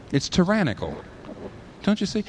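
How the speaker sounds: usual speaking voice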